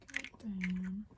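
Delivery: ordinary talk, nothing marked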